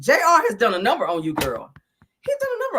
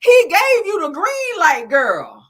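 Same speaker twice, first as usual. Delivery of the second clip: hyped-up cheer; 6 semitones higher, 7 dB louder, slower than usual. Acted. Hyping a friend on reality show.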